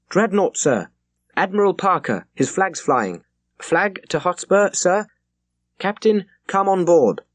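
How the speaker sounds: high, loud naval hail; officer hailing the captain; hailing call. Acted. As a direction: call it across the water. Officer hailing across water.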